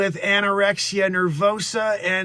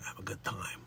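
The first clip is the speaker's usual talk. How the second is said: lightly voiced Boston character, very low and soft; a murmured bit of a Boston character; Dick Facce; 11 semitones lower, 18 dB quieter than usual. Recalling his original character's line, low Boston voice. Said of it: "That's my original character, Dick Facce".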